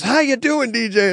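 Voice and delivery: high-pitched imitation of an eager host; hyped-up greeting. Host welcoming a guest on the show, hyped up. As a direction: greet them with mock high energy. Host's over-energetic greeting retold.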